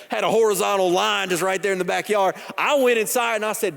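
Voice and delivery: ordinary delivery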